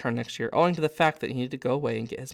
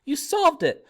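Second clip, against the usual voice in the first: much higher, louder excited cry; screaming with joy; 12 semitones higher, 6 dB louder than usual. Acted. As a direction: burst out with relief. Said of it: "running toward him screaming".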